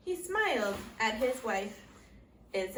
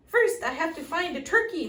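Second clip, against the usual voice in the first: higher, louder bellow; bellowing back; 6 semitones higher, 7 dB louder than usual. A narrator's reading. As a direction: bellow the reply uphill. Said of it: "Mister Moose bellowed back".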